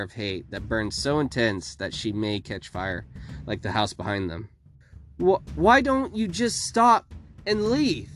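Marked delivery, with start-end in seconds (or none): 5.2-8.1 pleading. A narrator's reading. Sister pleading with villainess.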